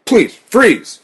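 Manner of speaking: loud, high-pitched barked order; two loud, high bursts of command; police shout. A narrator's reading. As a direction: shout it as a sharp command to stop. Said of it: "They shouted".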